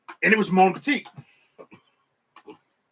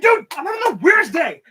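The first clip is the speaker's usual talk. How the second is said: higher and louder, bewildered outburst; exasperated shout; 9 semitones higher, 6 dB louder than usual. Retold or imitated. Telling the small woman about his day, loud bewildered shout. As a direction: blurt it out overwhelmed. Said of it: "I was like".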